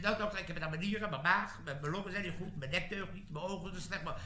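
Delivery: very soft, quick list of ailments; feeble ailing recruit. Acted. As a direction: plead sickness in a small voice. Recruit lists ailments to doctor.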